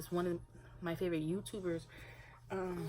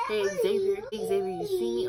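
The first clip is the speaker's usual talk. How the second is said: put-on louder, higher call to catch the toddler's eye; calling the baby; 4 semitones higher, 9 dB louder than usual. Shown as a demonstration. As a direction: call out to make him look at you. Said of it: "we're feeding him".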